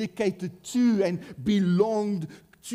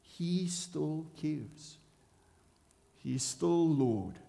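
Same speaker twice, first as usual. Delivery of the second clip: very slow, soft and tender; tender reassurance; 4 dB quieter, slower than usual. Real, not acted. Preacher comforting his flock about their pain, slow and tender. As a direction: let each word sink in gently.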